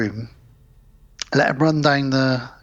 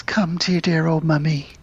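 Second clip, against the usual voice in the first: imitating Mum; 3 semitones higher than usual. Mother whispering to her undead son, tender whisper. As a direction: breathe it out like a doting mother. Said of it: "and says".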